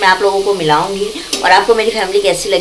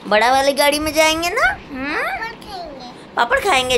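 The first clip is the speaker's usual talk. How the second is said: put-on baby talk; 10 semitones higher than usual. Coaxing the children to laugh.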